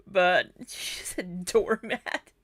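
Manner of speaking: words giving way to giggles; drawn out as the laugh takes over; breaking into laughter. Real, not acted.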